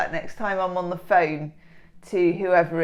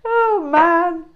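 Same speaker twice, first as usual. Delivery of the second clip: high, loud amused sigh; 10 semitones higher, 6 dB louder than usual. Real, not acted. Still laughing at the carb shirt, high amused. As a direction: chuckle it out.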